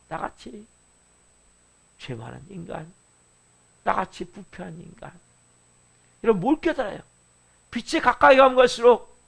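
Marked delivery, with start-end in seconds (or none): 0.1-5.1 hushed, much softer self-reproach; humble confession. Acted. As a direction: confess it under your breath, humbly. Humble confession of own sinfulness. (낮게 읊조리는 겸손한 고백)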